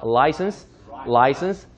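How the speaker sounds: "license" with a clear L onset; tongue-tip L. Shown as a demonstration. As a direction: say "license" starting with the tongue touching for L. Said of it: "혓바닥 붙여서 출발하는 거".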